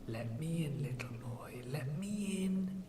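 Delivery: breathy, menacing monster whisper; sinister taunting whisper. Acted. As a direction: taunt them through the door in a creepy whisper. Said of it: "sinisterly and maliciously, taunting us".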